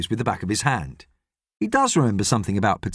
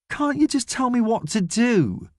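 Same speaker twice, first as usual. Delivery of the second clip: pleading; 10 semitones higher than usual. A narrator's reading. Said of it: "pleads James".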